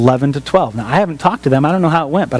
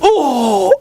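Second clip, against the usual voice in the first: humbled realization; 8 semitones higher than usual. Retold or imitated. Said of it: "And I was like".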